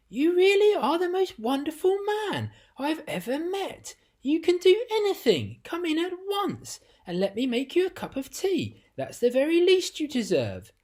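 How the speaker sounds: high, loud, delighted gush; cried out with joy. Acted. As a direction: gush over him, overjoyed. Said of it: "cried out".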